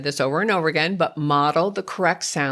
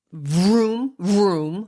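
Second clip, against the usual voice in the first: playful vroom; 3 semitones higher than usual. Shown as a demonstration. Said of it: "you go".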